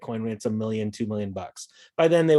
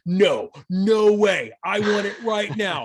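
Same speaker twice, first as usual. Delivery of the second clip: impatient buyer; 5 semitones higher, 4 dB louder, slower than usual. Retold or imitated. Impatient buyer demanding gold now.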